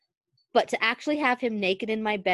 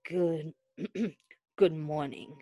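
lightly voiced low rumble; 4 semitones lower, 5 dB quieter than usual. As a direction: rumble it sleepily. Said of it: "he rumbled".

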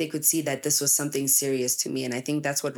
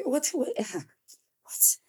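whispered, laughing what; half-laughing whispered disbelief; incredulous whisper; 9 semitones higher, 3 dB quieter, much slower than usual. Real, not acted. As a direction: breathe out a baffled what. Incredulous reaction to parents.

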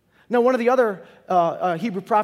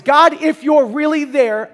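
pleading cry; 6 semitones higher, 9 dB louder, slower than usual. Pretend. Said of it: "your only cry is".

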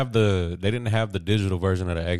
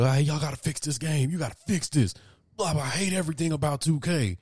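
higher-pitched mock whining complaint; complaining gamers; 7 semitones higher than usual. Gamer mimics fans griping about the game, mock complaining. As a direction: imitate fed-up fans griping online. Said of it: "people on Twitter and social media complaining".

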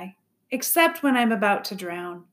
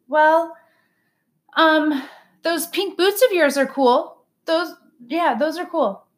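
put-on flustered boy; 7 semitones higher, 4 dB louder than usual. Bob fumbling compliment, put on the spot.

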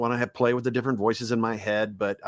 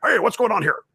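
much higher, louder blustering editor; Conspiracy the caller ranting about Spider-Man; J. Jonah Jameson; 9 semitones higher, 4 dB louder than usual. Retold or imitated. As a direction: bark like a hot-headed newspaper boss. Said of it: "Conspiracy is basically J K Simmons. ... Jonah Jameson".

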